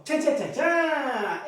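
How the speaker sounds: drawn-out mock fanfare "ta-da"; slow suspense jingle by mouth; drumroll sting. Sung suspense sting before the answer.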